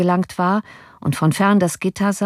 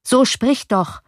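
higher, insistent prompt; impatient urging; 6 semitones higher, slower than usual. A narrator's reading. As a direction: press him to speak up. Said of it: "forderte der Ratspensionär ihn auf".

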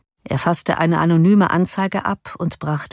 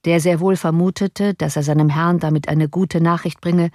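usual speaking voice

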